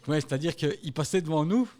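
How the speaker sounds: ordinary talk, nothing marked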